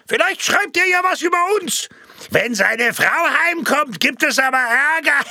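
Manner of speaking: high, loud joking call from the crowd; laughing heckle. Acted. Audience member jokes to the crowd, high laughing call. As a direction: toss it in amid laughter. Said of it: "im allgemeinen Gelächter".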